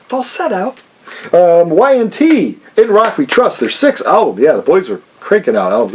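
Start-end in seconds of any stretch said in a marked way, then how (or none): 0.1-0.8 the word falsetto sung up high and softer, in falsetto; playful falsetto. Shown as a demonstration. Vlogger enjoying saying the word, in high falsetto. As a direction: toss off falsetto in a silly high falsetto. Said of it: "I just like saying that".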